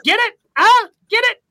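high, loud mock-dumb pitch for the bookers; mocking the booking team's name choice.

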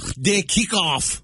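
slow, high Scottish brogue; hammy Scottish announcer; Scottish accent. Pretend. Scottish impression requested once more.

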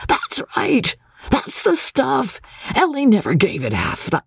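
high, gleeful cheering, hardly able to sit still; frenzied excitement. A narrator's reading. Captain cheering the new actress at rehearsal, excited. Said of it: "scratching the mutton chop whiskers in a frenzy of excitement".

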